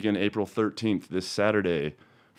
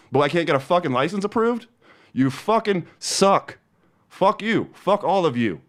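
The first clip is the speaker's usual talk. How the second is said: screaming; 8 semitones higher, 4 dB louder than usual. Real, not acted. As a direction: scream it into the mic. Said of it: "me screaming into a microphone".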